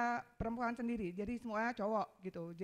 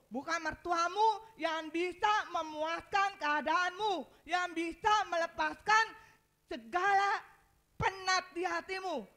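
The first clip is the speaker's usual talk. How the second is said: building, insistent sermon litany, louder and higher; full-force preaching; 4 semitones higher, 8 dB louder than usual. Acted. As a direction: drive each phrase harder to a slow, loud climax.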